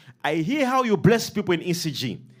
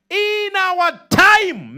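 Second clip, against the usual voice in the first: drawn-out shout; 9 semitones higher, 5 dB louder, much slower than usual. Acted. Preacher calling out the prayer.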